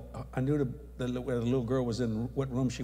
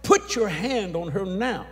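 slow, louder commanding order; firm command; 6 semitones higher, 6 dB louder, slower than usual. Retold or imitated. Preacher to father Ken, slow firm command. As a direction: insist slowly, each word weighed. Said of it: "I said".

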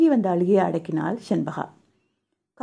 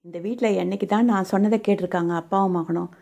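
plain reading voice

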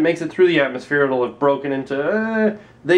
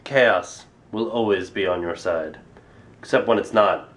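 mock ominous narrator; 10 semitones lower than usual. Ominous sign-off.

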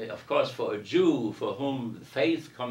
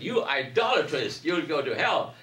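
raised, higher mock scolding of idol worshippers; mock condemnation; 5 semitones higher, 5 dB louder than usual. Paul hypothetically damning the Athenians, mock angry and raised. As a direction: play Paul damning the crowd, hammed-up and louder.

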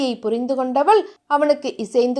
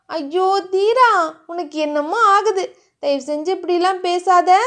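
embarrassed pleading; 4 semitones higher than usual. Acted. Heroine begs her teasing lover to stop, embarrassed. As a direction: plead coyly in a higher voice.

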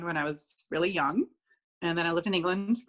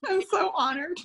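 laughing, high mock award gush; mock honored; 11 semitones higher than usual. Accepting a pretend award, laughing and high.

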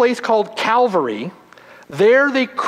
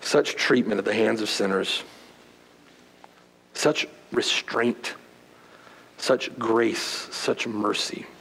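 hushed awe; 9 semitones lower, 7 dB quieter than usual. Real, not acted. Preacher marvelling at Christ's grace, hushed and reverent. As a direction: let the words fall quiet in awe.

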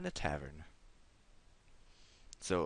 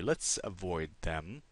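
usual speaking voice

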